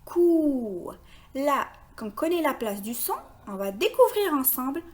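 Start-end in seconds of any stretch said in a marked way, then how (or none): 0.1-0.9 cou with a long drawn-out oo; isolated syllable. Shown as a demonstration. Teacher gives the answer, cou held long. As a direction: stretch the vowel of cou. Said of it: "la syllabe".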